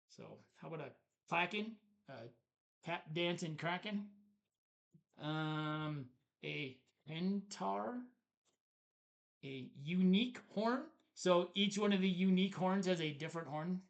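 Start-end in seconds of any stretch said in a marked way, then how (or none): none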